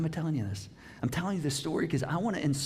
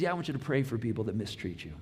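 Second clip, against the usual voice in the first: lower voice as Jesus; 7 semitones lower, 3 dB quieter than usual. Retold or imitated. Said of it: "Jesus says".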